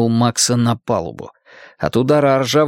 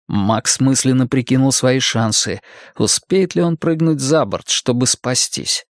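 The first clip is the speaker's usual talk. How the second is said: ordinary delivery, nothing marked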